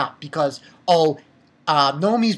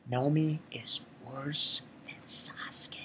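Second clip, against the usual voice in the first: slow, very soft whisper; hushed, deliberate bombshell; confiding whisper; 5 semitones lower, 16 dB quieter, slower than usual. Real, not acted. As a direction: whisper it like a shocking confession. Whispers his announced crazy claim.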